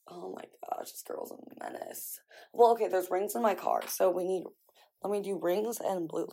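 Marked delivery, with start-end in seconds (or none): none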